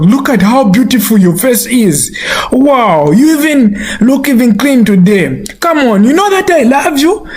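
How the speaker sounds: high-pitched scolding without real volume; acted shout, pitch climbing, only mildly forceful; mock shouting. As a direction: praise the dog as if telling it off. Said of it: "he shouts at the dog".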